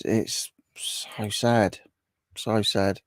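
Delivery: whispered, very slow, so sad; hushed sad. Real, not acted. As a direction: let it drop to a sad whisper. Responding to sad chat comment.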